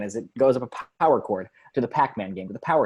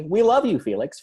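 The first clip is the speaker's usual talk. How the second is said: higher, louder crowd cheer; adoring cheer; 7 semitones higher, 4 dB louder than usual. A narrator's reading. Partygoers to Felix as fireworks burst, adoring cheer. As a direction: call it out like cheering fans.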